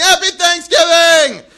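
high, loud comedian-style holler; mock crowd yelling back, drawn out and high; slow, high-pitched shouted caricature; Seinfeld. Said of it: "they all sound like Seinfeld in my head".

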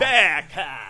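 mock Australian. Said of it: "slow and deliberate with their pronunciation".

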